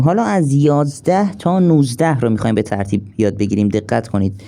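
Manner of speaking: ordinary talk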